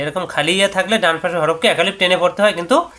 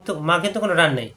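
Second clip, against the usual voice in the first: usual speaking voice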